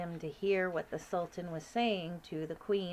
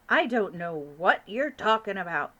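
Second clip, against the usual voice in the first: irritated, louder king; testy king; 8 dB louder than usual. Acted. King of Sweden losing patience, testy. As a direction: get a bit annoyed. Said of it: "growing testy".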